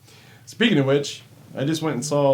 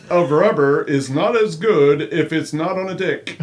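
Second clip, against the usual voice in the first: read through laughter; 5 semitones higher, 8 dB louder than usual. Retold or imitated. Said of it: "Here's what you said last night".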